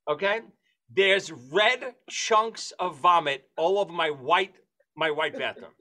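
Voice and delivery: normal talking voice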